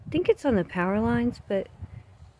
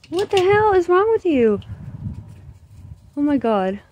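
high-pitched, worried questioning; alarmed question to dog; 9 semitones higher than usual. Real, not acted. Owner asks dog what is wrong, high and alarmed. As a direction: ask the dog in a raised, anxious pitch.

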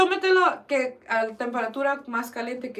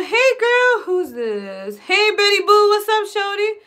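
loud, high-pitched sassy hello; playful hype greeting; 9 semitones higher, 7 dB louder, slower than usual. Pretend. Streamer hamming up greetings to her viewers, playful hype. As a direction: call out big and high, teasing.

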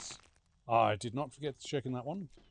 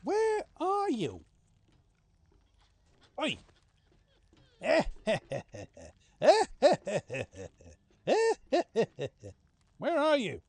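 higher, louder playful menace; mock menacing hunter; 7 semitones higher, 6 dB louder than usual. Player hunting a hiding player, playful villain taunt. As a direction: taunt the hiding victim like a cartoon killer. Taunting hidden prey as killer.

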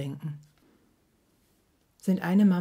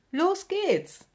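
much higher bright call to set off; cheerful mum calling, far higher; 13 semitones higher than usual. A narrator's reading. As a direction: call out happily to get going. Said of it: "ruft sie fröhlich".

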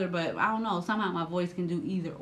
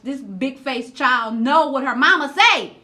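much louder, higher exasperated rant; venting, louder and higher, slowly; real frustration; 6 semitones higher, 7 dB louder, slower than usual. Real, not acted. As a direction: let the anger spill out, slow and loud. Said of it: "It was making me so mad".

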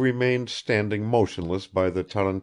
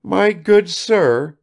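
put-on very slow, higher, louder old woman; weeping as she speaks; mother tearfully addressing the guest; through tears; 10 semitones higher, 8 dB louder, much slower than usual. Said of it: "said the old woman through her tears".

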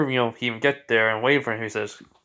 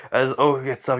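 usual speaking voice

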